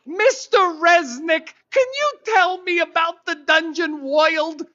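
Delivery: put-on a little boy with a New York accent, drawn out. Little boy Russell asking the shopkeeper, in a New York accent. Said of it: "why he has a New York accent".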